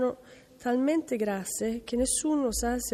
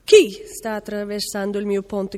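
loud shout, hardest on the first word; troll's shout; 9 dB louder than usual. A narrator's reading. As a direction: bellow the challenge as the troll. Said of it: "gridò il troll". (grido forte del troll, minaccioso)

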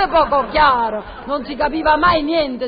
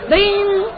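vocal phone ring; 8 semitones higher than usual. Acted. Actor voicing the script's telephone cue with a mock ring. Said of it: "squillo di telefono".

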